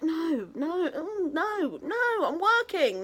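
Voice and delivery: mock-prim I'm working; prim refusal. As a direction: turn it down like a prim professional. Refusing friends' suggestion.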